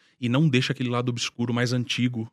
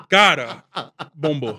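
normal talking voice

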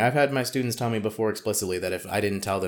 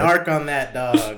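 slow, louder, every word hammed up; mock emphatic command; 3 semitones higher, 5 dB louder, much slower than usual. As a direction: drag out a joking order to snitch. Joking advice, repeated.

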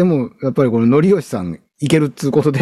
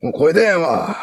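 mock rough MC calling for water, higher and slow; hammed-up worn-out singer croaking for water; hoarse MC voice; 5 semitones higher, slower than usual. Hosts joke about a rough-voiced MC, mock hoarse shout. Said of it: "よっぽど声ガラガラやろし".

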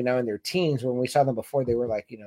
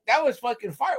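higher, hyped up; hyped approval; 7 semitones higher than usual. Retold or imitated. Fan cheering Daemon's kill, hyped. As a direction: praise it like a hype fan. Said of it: "I was like".

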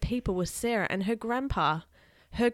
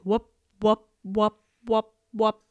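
imitated chopper whoops, punched out loud; evenly beaten whirring-blade noise; loud whipping noise; 6 dB louder, slower than usual. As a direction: beat out loud rotor sounds. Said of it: "the loud whipping noise started".

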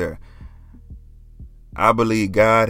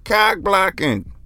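imitating Martin; 8 semitones higher, slower than usual. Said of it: "And Martin was like".

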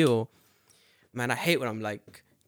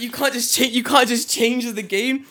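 indignant protest; 11 semitones higher, 7 dB louder than usual. Retold or imitated. Protesting to the serious guy, high indignant whine. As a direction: complain the rules are unfair.

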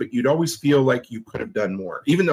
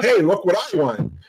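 higher, playful mock brag; joking boast; 6 semitones higher than usual. Joking at the draw before his wife wins, mock boast. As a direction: crow jokingly about a win. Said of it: "the only one that I jokingly said".

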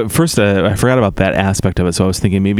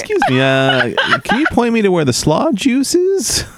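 high shopper voice; 11 semitones higher than usual. Acted. Playing a shopper.

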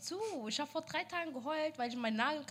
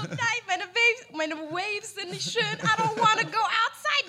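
slow, raised, louder mock wail; whiny protest; 6 semitones higher, 7 dB louder, slower than usual. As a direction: imitate yourself tearfully refusing, hammed up. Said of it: "Ich so".

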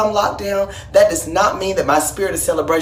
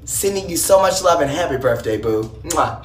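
ordinary talk, nothing marked

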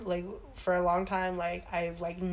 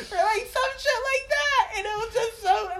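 very high, loud laughing squeal; helpless laughter; 5 dB louder than usual. Real, not acted. Cracking up over British replies, high laughing squeal. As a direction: squeal the words through laughter. Cracking up while telling a joke.

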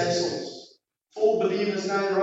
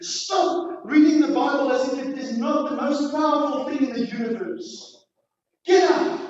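raised, high-pitched exhortation from the pulpit; forceful preaching; 5 semitones higher than usual. Real, not acted. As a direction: urge the congregation hard, punching each command.

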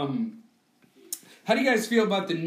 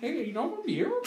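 high, softer celebrity impression; Jay Leno; 8 semitones higher, 5 dB quieter, faster than usual. Host asking friends if they heard the casting news, high impression voice. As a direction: put on a high talk-show host's voice. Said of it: "one of my perfect Jay Leno".

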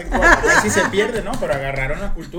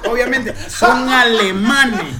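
laughing; 6 semitones higher, 7 dB louder, slower than usual. Real, not acted. Retelling history amid laughter.

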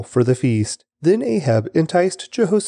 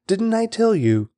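a higher, told-you-so outburst; a mild I-knew-it exclamation; exclaimed; 8 semitones higher than usual. Acted. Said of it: "the king of Israel exclaimed".

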